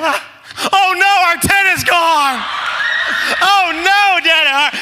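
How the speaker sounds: very high, loud mock wail; bawling child. Son sees the tent gone and wails to Dad, bawling. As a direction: imitate a little boy freaking out and wailing. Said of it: "He's, like, bawling his eyes out".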